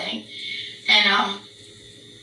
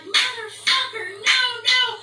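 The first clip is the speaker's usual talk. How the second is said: retold frantic yelling; 11 semitones higher than usual. Clapping her hands to scare off the bear, mock yell. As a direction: imitate frantically shooing, voice way up.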